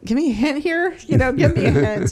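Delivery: high, loud exasperated plea; high, loud begging for a hint from God; pleading to God. Retold or imitated. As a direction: beg the heavens for a sign. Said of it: "And I was just like".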